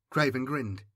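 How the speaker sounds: ordinary delivery, nothing marked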